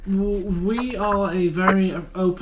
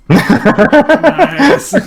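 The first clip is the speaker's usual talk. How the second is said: high, loud laughing nice; 7 semitones higher, 8 dB louder than usual. Real, not acted. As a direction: burst out laughing on it. Reacting to toilet map joke.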